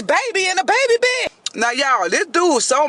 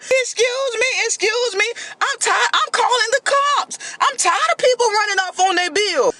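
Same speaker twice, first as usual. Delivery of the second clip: fed-up waiter; 7 semitones higher than usual. Retold or imitated.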